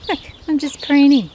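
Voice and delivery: high, loud innocent duck voice; voicing the duck. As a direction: play the drake feigning innocence. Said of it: "he's now pretending he's".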